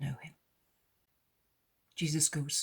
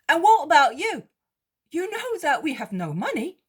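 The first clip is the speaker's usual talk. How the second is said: higher, louder voice for Peter; Peter, remarking; 13 semitones higher, 10 dB louder than usual. Acted. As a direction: Peter pressing a worried point, raised and high. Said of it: "remarks Peter".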